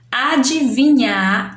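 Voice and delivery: "Adivinhar" slowly, as "a-di-vi-nhar"; deliberate, stretched "Adivinhar"; syllable by syllable. Shown as a demonstration. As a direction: measure out each syllable of "Adivinhar". Teacher sounding out next word.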